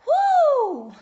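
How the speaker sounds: loud high whoop; sudden loud high whoop; excited whoop. Real, not acted. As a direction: let out a loud high woo. Exclaims in the middle of the lesson.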